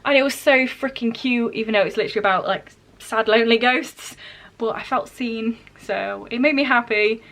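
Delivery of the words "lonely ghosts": normal talking voice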